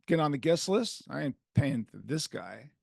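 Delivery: ordinary delivery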